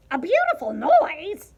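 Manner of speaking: high, loud indignant pig; huffy pig. A narrator's reading. Pig affronted by the cockroach's wish, loudly huffy. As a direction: huff it back as a squealy question. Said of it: "huffed Pig".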